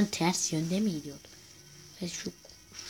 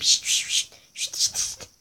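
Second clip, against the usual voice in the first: shushing calls; 4 dB louder than usual. Real, not acted. Calling a dog in game.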